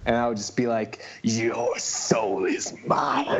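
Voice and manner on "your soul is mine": slow, drawn-out sinister villain voice, laughing; menacing supervillain, dragged out slowly; Shang Tsung. Retold or imitated. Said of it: "like, Shang Tsung".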